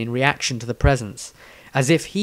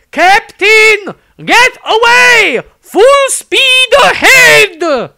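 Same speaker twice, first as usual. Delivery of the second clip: very high, loud and slow, full-lung order; shouted command; 11 dB louder, much slower than usual. A narrator's reading. Sister ordering the captain to flee, loud high drawn-out shout. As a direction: roar it across the whole barge. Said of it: "shouted a command at the top of her powerful lungs".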